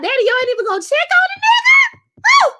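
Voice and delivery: rising, fast outraged shout; fast angry shout. Real, not acted. Ranting at Prosper's family.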